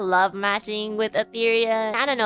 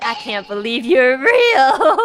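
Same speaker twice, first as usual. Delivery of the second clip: slow, louder, laughing through it; half-laughed disbelief; 5 semitones higher, 6 dB louder, slower than usual. Retold or imitated. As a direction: laugh while saying it, amazed. Meeting Doppio in person, laughing.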